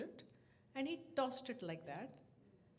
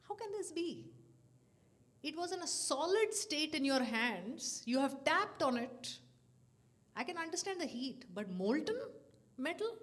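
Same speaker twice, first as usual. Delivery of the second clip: astonished questioning; 7 semitones higher, 6 dB louder than usual. Retold or imitated. Astonished at the molten box.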